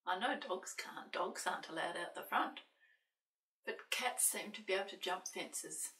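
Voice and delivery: usual speaking voice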